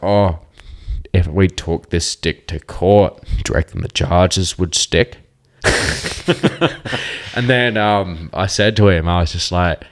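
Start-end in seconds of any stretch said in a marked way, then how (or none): 0.0-5.2 louder mock-serious stoned musing; stoned joke. Tripping on acid joking to Alistair about a stick, loud. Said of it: "I was like". 5.6-7.3 high breathy burst of laughter. Real, not acted. Laughing at his own stick pun, high.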